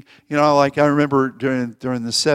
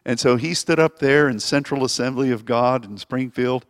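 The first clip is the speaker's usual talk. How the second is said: ordinary talk, nothing marked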